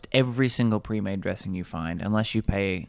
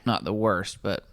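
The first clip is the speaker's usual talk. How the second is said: ordinary talk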